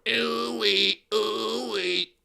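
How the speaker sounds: soft, robotic ooh-wee like a talk box; talk box imitation. Mocking [name]'s voice as a [name] and Zapp talk box, soft and robotic. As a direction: sing it through an imagined voice tube. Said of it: "like [name] and Zapp things in her throat".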